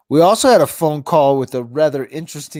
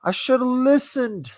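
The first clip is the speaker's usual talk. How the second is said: higher, sing-song mock apology; mock high contrite voice; 11 semitones higher than usual. To the voicemail caller, a mock high apology. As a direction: play at being sheepishly wrong. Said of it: "And I was like".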